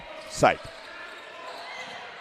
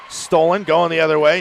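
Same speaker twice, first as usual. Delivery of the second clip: sudden loud, high steal call; raised, urgent commentator voice; excited play-by-play; 4 semitones higher, 6 dB louder than usual. Real, not acted. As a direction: jump on the turnover.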